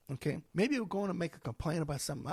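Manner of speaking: ordinary talk, nothing marked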